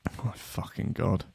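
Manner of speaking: breathy whispered disbelief under the breath; disbelieving whisper. Real, not acted. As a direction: mutter it in stunned disbelief, barely voiced. Reacting in disbelief to news story.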